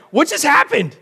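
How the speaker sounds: high, loud startled question; stunned disbelief. Retold or imitated. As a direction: blurt it out in shock, pitch shooting up. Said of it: "And I was like".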